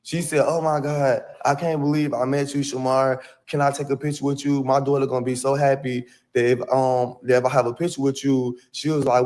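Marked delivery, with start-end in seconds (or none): none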